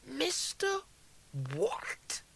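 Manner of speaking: high, halting, half-whispered question; stunned disbelief. A narrator's reading. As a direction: break it in two, incredulous. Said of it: "He half smiled in disbelief".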